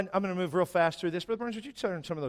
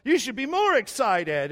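high, loud, put-on eager agreement; hammed-up mock excitement; fake enthusiasm; 8 semitones higher, 6 dB louder than usual. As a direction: play the confident leader while faking it. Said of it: "I was faking being the spiritual leader".

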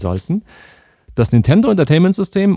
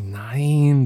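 usual speaking voice

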